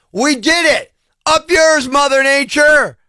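high-pitched victory cry, drawn out at the end; triumphant taunt. Acted. Rider taunting Mother Nature after finishing the ride, triumphant.

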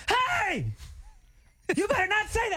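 high, sharp shout at the bullies; mock angry yell. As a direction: yell a warning at big guys. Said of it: "And you were like".

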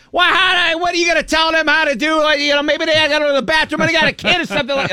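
imitating a fed-up fan snapping back; mock angry Philly woman. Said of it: "the woman turns around, she goes".

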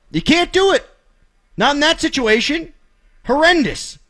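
exasperated outburst. Real, not acted. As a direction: vent at the blunder. Ranting about a baserunning blunder.

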